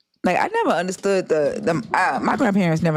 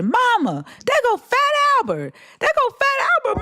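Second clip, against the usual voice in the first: put-on child yelling out. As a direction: yell it out like a little boy in church. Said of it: "he just yells out in Bible study".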